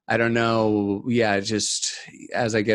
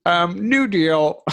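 mock pompous voice; 7 semitones higher, 3 dB louder, much slower than usual. Mocking Bill Maher.

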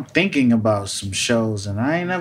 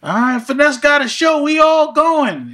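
much higher, louder hype, slowing on the last words; rally the crew like a whole block is coming; hyped friends; 5 dB louder than usual. Retold or imitated. The project crew hyping [name]'s show, loud and high.

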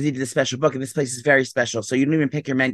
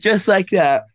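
talking through a yawn; stretched yawn mid-word; 5 semitones higher, 5 dB louder, much slower than usual. Real, not acted. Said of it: "I'm yawning".

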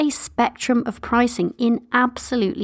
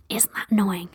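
whispering; 5 dB quieter than usual. Real, not acted. Aside to listeners, teasing.

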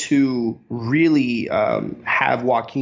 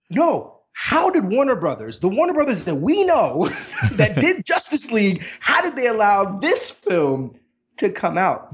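high, laughing disbelief; incredulous; 7 semitones higher than usual. Acted. Cohost marveling that the studio allowed this film, incredulous. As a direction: marvel at it, pitched well up with a laugh. Acts out own astonished disbelief.

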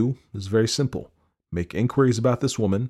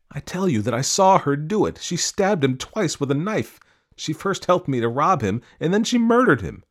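urgent, rising higher pleading; insist, climbing higher to the end; desperate protest; 9 semitones higher, 4 dB louder than usual. A narrator's reading. Ferryman pleads with the constable, urgent rising protest.

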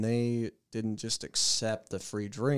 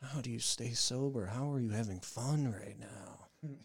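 mock earnest whisper; 9 dB quieter than usual. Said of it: "asking me".

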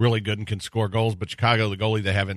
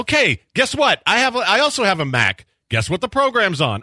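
high, loud mocking challenge; exasperated raised voice; 10 semitones higher, 5 dB louder than usual. Real, not acted. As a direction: push back loudly. Exasperated retort about the robot.

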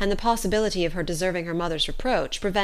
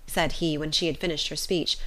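usual speaking voice

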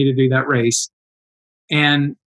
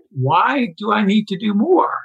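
higher, drawn-out retold objection; incredulous protest; 6 semitones higher than usual. Runner retelling his reply to "do more", incredulous. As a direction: question it slowly, pitched up in disbelief.

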